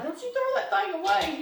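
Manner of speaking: high, loud mock husband scolding; wife teasingly voicing husband, high and loud; imitating husband. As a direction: mimic John guarding his old pan. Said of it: "John's like".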